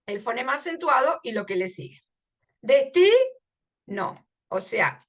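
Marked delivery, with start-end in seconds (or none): 2.6-4.3 Destino said very slowly and louder, leaning on TI; slow stressed demo. Shown as a demonstration. Teacher showing the stressed vowel of Destino, slow and loud. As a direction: draw Destino out hard on the stressed syllable. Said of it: "I es el fonema acentuado".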